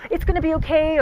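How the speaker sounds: lightly mocked chin-up comforting; placating. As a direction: imitate men trying to fix her upset. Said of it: "they kept saying things like".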